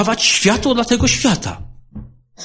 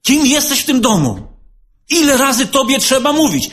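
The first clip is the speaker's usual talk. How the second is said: raised, higher scolding voice; half-shouted scolding; 8 semitones higher than usual. Retold or imitated. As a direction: scold the housemate almost shouting. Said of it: "krzycząc prawie na niego". (na wpół krzyczący, podniesiony głos)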